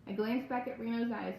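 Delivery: plain reading voice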